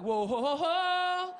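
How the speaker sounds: drawn-out rising "whoa"; rolling with it. Shown as a demonstration. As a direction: demonstrate a placating whoa, sliding upward. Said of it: "you gotta kinda roll with".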